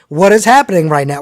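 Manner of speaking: loud, disbelieving outburst; overwhelmed excitement. Retold or imitated. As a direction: shout it out in happy disbelief. Overwhelmed by the wrestling moment.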